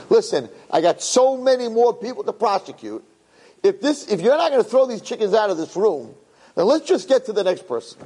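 high, fed-up prosecutor giving up; exasperated Satan. Acted. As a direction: throw up your hands over the racket. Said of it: "the Satan says to Beis Din".

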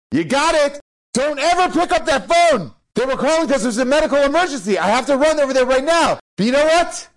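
loud, high, urgent telling-off; acted outraged uncle; angry scolding. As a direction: lay into the kid at full pitch. Furious uncle scolds nephew.

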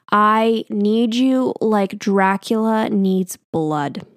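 very slow, drawn out word by word; slow dramatic line. Retold or imitated. Nurse ending his poem, drawn out dramatically. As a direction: stretch every word as a mock grand finale.

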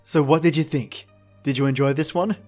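usual speaking voice

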